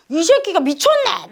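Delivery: loud, high, drawn-out scolding; angry outburst. Acted. Boss losing temper at a defiant subordinate, angry outburst. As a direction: blow up at an insolent underling, slow and loud. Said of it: "막 이렇게 화를 내는 거예요".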